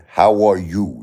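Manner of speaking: emphasize the final "you"; "how are YOU", with the weight on the last word; stress on YOU. Shown as a demonstration. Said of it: "you gotta emphasize the you".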